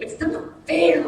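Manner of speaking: put-on a kid's sulky it's not fair; childish whine. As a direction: mimic a pouting eight-year-old. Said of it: "like my eight-year-old children do".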